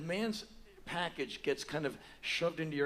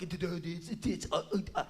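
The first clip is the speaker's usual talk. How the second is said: tongue-tied, higher stuttering; starstruck stammer; 4 semitones higher, faster than usual. Retold or imitated. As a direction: stammer as words fail before your idol. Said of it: "I was starstruck".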